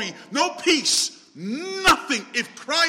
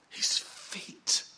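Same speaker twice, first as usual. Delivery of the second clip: whisper; 9 dB quieter than usual. Acted. Hushed climax of the foot-washing story.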